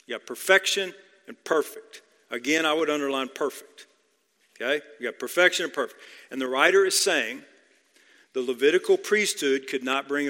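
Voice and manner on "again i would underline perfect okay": ordinary talk, nothing marked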